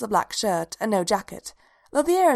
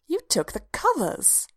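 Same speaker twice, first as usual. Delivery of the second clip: high, drawn-out mock indignation; indignant complaint; 10 semitones higher, slower than usual. A narrator's reading. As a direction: complain in a high, slow whine to a bedmate. Annoyed wife accuses sleeping husband.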